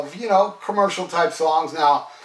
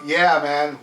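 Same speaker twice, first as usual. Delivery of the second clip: ordinary delivery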